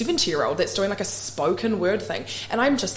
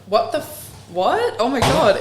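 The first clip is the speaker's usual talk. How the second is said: retold disbelief; 6 semitones higher, 5 dB louder than usual.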